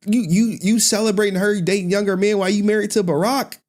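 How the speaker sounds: high, loud incredulous rant at Michelle; outraged, half-laughing disbelief. Real, not acted. Ranting at Michelle Obama clip.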